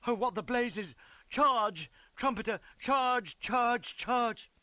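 high, loud battle cry; shouting. A narrator's reading. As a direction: scream out the order to attack. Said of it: "with Beatson at my elbow shouting".

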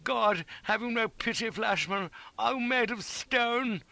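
high, slow, stricken lament; anguished groaning. A narrator's reading. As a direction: plead like a man stricken. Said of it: "over and over again and groaning".

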